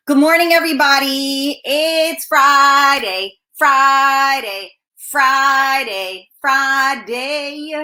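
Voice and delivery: long held chanting calls of Friday; drawn-out Friday cheer. Real, not acted. Host greeting everybody on Friday morning, drawn-out cheer. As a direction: stretch every Friday out like a hype call.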